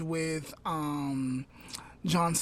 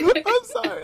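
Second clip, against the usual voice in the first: much higher, louder giggling sorry; laughing apology; 6 dB louder than usual. Real, not acted. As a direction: apologize while cracking up.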